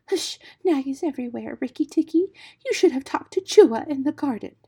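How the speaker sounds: high, anxious warning voice; frightened muskrat. A narrator's reading. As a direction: warn in a high, fretful little voice. Frightened muskrat warns the mongoose.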